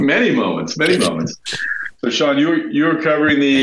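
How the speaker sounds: sounds like a bag over the head; muffled, poor headset sound; muffled audio. Real, not acted. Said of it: "I'm gonna be a little muffled".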